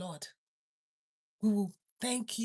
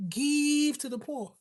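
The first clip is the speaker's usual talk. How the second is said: 1 proclaiming Jesus; 5 semitones higher, 4 dB louder, slower than usual. Acted. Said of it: "Jesus said".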